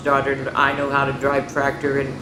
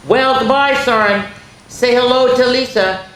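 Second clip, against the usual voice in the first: father voice; 8 semitones higher, 5 dB louder than usual. Acted.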